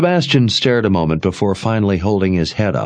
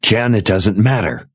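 ordinary delivery

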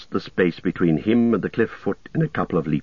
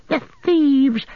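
much higher, louder creature shriek on "thieves"; 4 dB louder than usual. A narrator's reading. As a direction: screech "the thieves" in outrage. Gollum shrieks repeat.

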